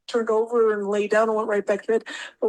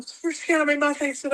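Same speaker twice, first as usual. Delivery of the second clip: ordinary delivery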